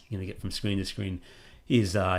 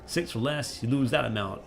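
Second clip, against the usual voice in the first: put-on light American accent, pitched higher; a mild put-on American accent, higher and a bit louder; Wolfgang, American accent; 4 semitones higher, 3 dB louder than usual. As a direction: read the dice rules as an American tavern keeper. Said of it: "I'm speaking in an American accent for Wolfgang".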